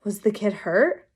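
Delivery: ordinary delivery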